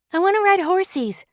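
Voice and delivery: put-on high child's voice; a small girl wanting her way; little girl.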